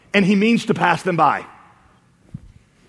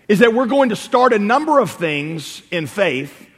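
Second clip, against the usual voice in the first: ordinary delivery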